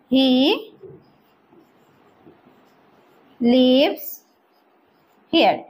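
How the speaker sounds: I ... live ... near ... here, each word set apart; slow word-by-word drill. Shown as a demonstration. Teacher drilling a new phrase, slow word by word. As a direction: separate every word with a long pause. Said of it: "teacher drills word by word".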